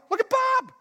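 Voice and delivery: very high-pitched amazed cries; astonished onlookers. Acted. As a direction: squeal it in sheer disbelief. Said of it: "he got up and went".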